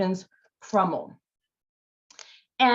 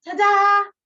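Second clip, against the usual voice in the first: higher, louder fanfare; showy reveal; 10 semitones higher, 6 dB louder than usual. Acted. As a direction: present the donkey proudly. Fox's reveal.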